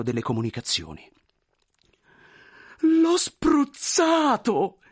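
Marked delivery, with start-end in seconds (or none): 2.8-4.8 slow, drawn-out, much higher alarmed cry; horrified thought. Acted. Clerk realising he sprayed the general, drawn-out horrified cry. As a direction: gasp it out in dismay. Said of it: "pensò Chervyakov".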